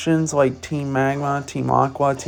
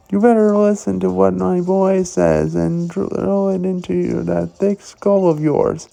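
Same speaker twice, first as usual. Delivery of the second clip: higher, cocky street-thug talk; fake tough gangster; 6 semitones higher than usual. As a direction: sneer it like a wannabe gangster. Voices Team Skull grunts.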